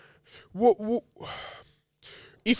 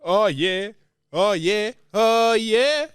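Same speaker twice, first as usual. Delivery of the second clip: rising, louder hype chant; hyped up; 7 semitones higher, 4 dB louder, much slower than usual. Real, not acted. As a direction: pump it up each time, climbing higher. Building hype chant with friend.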